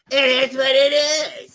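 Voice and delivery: loud, high drawn-out catchphrase; mocking imitation. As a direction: imitate her with a slow, hammed-up yell. Mimicking her catchphrase in retelling.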